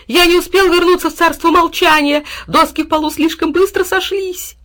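high, loud, excited babble; irrepressible dog. A narrator's reading. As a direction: keep exclaiming like an unstoppable dog. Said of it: "не унимался пёс". (неугомонный восторженный пёс)

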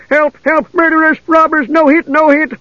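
high, squealing plea for help; very high-pitched, panicked begging; begging gully dwarf. Acted. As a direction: beg frantically in a little creature's voice. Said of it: "begged the gully dwarf".

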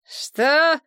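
surprised. A narrator's reading. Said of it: "удивлённо переспросила".

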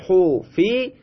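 حفی drawn out slowly as "hu-fi"; slow teacher's "huu-fii" on حفی; passive vowelling. Shown as a demonstration. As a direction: sound out حفی syllable by syllable, u then i.

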